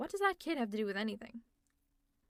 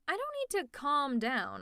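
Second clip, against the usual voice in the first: raised, drawn-out insistence; louder and higher, stretch out the denial; heated protest; 4 semitones higher, 5 dB louder than usual. Acted. Villain insisting to the hero they needn't calm down, heated.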